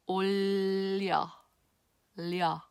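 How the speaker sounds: "올려" with the ㄹ held through, not "orla"; careful "올려"; Korean 올려 demo. Shown as a demonstration. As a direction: hold the tongue up across "올려". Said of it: "not orla, not or".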